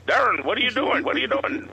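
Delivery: playful high Canadian impression, lightly teasing; Bob Proctor Canadian voice. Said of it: "in that cool Canadian voice".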